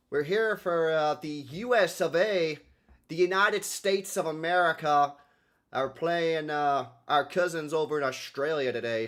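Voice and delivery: lightly put-on American accent; hammed-up American announcer accent. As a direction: introduce the match as a mock American host. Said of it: "the American one".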